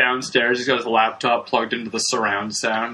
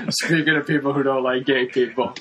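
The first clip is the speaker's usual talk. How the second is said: laughing; 3 semitones higher than usual. Real, not acted. Repeats segue laughing.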